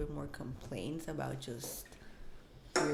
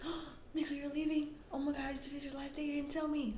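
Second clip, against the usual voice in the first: higher pitched, breathy mock shock; gasping dismay; 8 semitones higher, 3 dB quieter than usual. Coworkers learning it's Mixley's last day, mock gasping shock. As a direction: gasp then gush the disappointment. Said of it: "And everybody was like".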